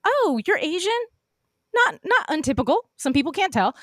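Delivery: high, questioning lilt; imitated surprise. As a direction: mimic someone puzzled and backpedalling. Retelling older man's clumsy surprise.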